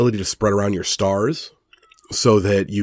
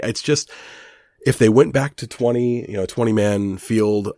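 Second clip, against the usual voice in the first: ordinary talk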